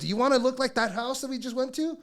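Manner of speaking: high, loud exasperated question; scolding. Retold or imitated. Friend warning about a blown-up house, imitated scolding. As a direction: scold with pitch pushed way up.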